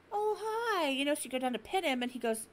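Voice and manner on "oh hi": high, sweet cat greeting; mock sweet greeting. Owner greeting the meowing cat, high sweet hello.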